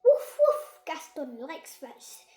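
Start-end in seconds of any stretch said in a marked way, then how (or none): 0.0-0.8 high, loud playful barking; dog bark. Acted. Gaston the ladybird barking at the children. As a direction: bark like an excited little dog.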